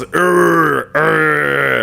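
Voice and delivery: two drawn-out throat growls; throaty growl. Shown as a demonstration. YouTuber demonstrating growls for a voice tip, throaty growl. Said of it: "when I do growls".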